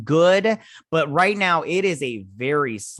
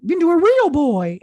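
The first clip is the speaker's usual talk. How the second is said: very high, loud put-on boy voice; mock wooden-boy wail; 9 dB louder than usual. Character laments his dad to himself, high mock wail.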